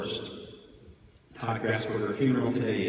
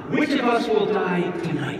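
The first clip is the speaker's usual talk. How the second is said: higher and louder, menacing; ominous preacher; 8 semitones higher, 7 dB louder than usual. A narrator's reading. Priest challenging the funeral crowd, loud ominous question. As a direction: demand it of every mourner. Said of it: "Priest goes on".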